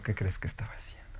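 hushed secret whisper; suspense whisper. Acted. Storyteller teasing the child with a mystery, in a hushed whisper.

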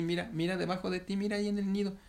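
high, eager pointing-out; excited urging. Acted. Monkey mother shows heron the nest, excited.

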